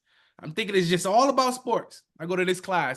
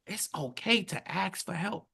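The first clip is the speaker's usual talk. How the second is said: gentle; 7 dB quieter than usual. Retold or imitated. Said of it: "She said".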